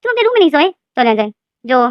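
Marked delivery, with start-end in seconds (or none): 0.0-0.7 surprised mother. Acted. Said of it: "लेनदेन की माँ बोलती है".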